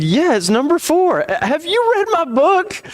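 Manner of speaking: high, eager gush of excitement; excited. Retold or imitated. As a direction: blurt it out like a thrilled fan. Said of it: "I was so excited".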